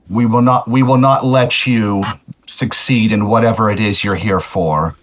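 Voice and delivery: normal talking voice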